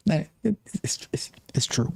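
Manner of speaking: drops to a secret whisper; whispered aside. Real, not acted. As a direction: whisper it like you shouldn't say it. Admitting something he says he won't say.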